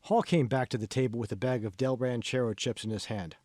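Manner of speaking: ordinary delivery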